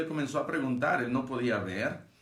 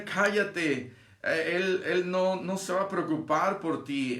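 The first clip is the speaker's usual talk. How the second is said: higher-pitched mock rebuke; reprensión imitada; 6 semitones higher than usual. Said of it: "le reprendían para que callase". (regaño imitado en tono agudo)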